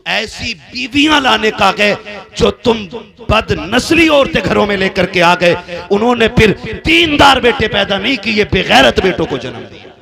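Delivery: loud, high, heated sermon delivery; full-force preaching. Acted. Preacher condemning the men's choice of wives, full-force preaching. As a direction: thunder it out at full volume.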